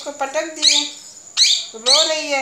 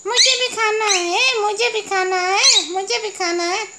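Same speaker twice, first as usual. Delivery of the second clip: higher, pleading pet voice; insistent little beggar voice; begging pet voice; 7 semitones higher than usual. Pretend. Voicing another pet begging.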